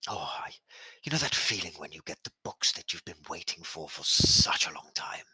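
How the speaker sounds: hushed, quick, longing whisper. Acted. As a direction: whisper like a book lover. General whispers about books.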